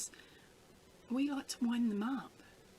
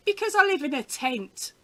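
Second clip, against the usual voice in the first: higher, louder imitated deadpan punchline; very serious; 6 semitones higher, 8 dB louder than usual. Mum answering the window salesman, acted very serious. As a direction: deliver it in mock earnest, with emphasis. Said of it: "she went, very serious".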